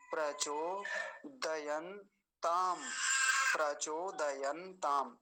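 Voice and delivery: प्रचोदयंताम very slow, द and य kept full; syllable-by-syllable प्रचोदयंताम, every consonant whole; careful pronunciation. Shown as a demonstration. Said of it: "दकार को और यकार को पूरा रखेंगे".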